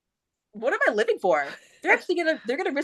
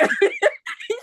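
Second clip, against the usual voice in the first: high laugh. Real, not acted. Laughing at the story.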